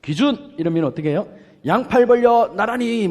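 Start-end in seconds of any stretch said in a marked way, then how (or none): 0.0-0.5 high, loud call; short shout across the field; shouted command. Shown as a demonstration. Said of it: "이렇게 외쳐요". 1.6-3.1 high, loud marching order; teacher shouting a lineup command; drill command. Shown as a demonstration. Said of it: "뭐 이렇게 하든지".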